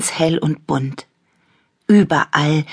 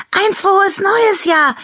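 very high, loud festive squeal; piercingly high cheering call; excited shout; 5 dB louder than usual. A narrator's reading. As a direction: shout the wish with glee. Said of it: "rief Annabella Klimperauge".

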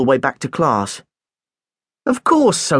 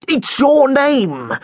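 snorting; 12 semitones higher, 3 dB louder, much slower than usual. Acted. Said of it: "he snorted".

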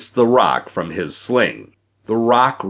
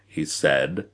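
usual speaking voice

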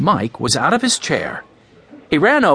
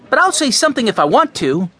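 put-on high-pitched boy's protest; arguing; 9 semitones higher than usual. A narrator's reading. Mike arguing with Benny over who talks, argumentative. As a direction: argue back in a raised pitch. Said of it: "argued Mike".

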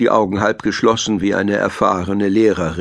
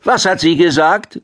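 higher-pitched, puzzled granny voice; grandmother badger; 7 semitones higher, 4 dB louder than usual. A narrator's reading. Grandmother badger asks her grandson what was said, puzzled higher voice. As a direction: ask as someone who missed it. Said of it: "fragte Großmutter Dachs ihren Enkel".

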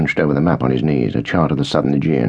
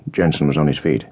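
plain reading voice

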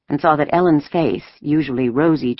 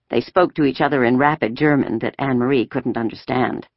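plain reading voice

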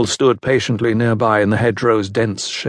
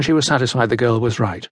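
plain reading voice

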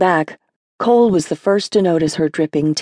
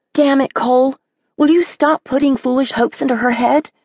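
higher, sharp rebuke of her brother; exasperated sister, raised pitch; angry outburst; 8 semitones higher than usual. Acted. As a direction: snap at him to stop the false hope. Sister Leah scolds Cole.

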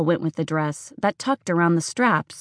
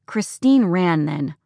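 usual speaking voice

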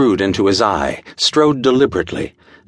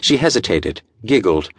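plain reading voice

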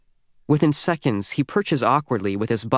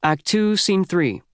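higher, announced across the room; calling out; 7 semitones higher, slower than usual. Acted. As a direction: call the scene out to the cast. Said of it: "Ms. Albright calls from the stage".

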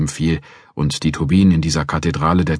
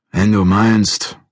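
reluctant giving in; 4 semitones higher, 4 dB louder, much slower than usual. Acted. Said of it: "gab Krabby widerwillig nach".

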